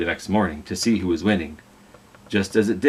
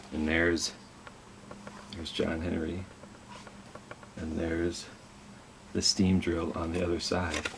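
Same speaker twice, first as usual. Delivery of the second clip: hushed aside pointing at the picture; 3 semitones lower, 12 dB quieter than usual. Real, not acted. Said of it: "aside to listening children".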